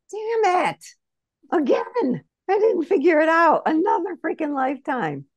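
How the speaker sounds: exasperated squeal. Retold or imitated. Self ascending from the stake, squeaky exasperated groan. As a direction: squeal it out in mock frustration.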